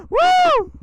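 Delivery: a loud, high whoop of thrill; excited whoop. Real, not acted. Rider whooping at viewers after a hard rev, loud high whoop. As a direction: let out a real whoop as the bike pulls.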